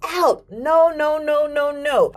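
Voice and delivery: high, strained mock toddler yelling; high childish shriek with a catch of tears; retold toddler screaming. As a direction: relive a little kid screaming at an intruder. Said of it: "I'm screaming to the top of my lungs".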